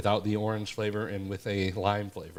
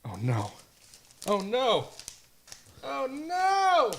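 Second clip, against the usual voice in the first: from normal pitch climbing to a very high wail; each "Oh, no" higher than the last; escalating mock dismay; slower than usual. Struggling with a comic book sleeve, escalating mock dismay.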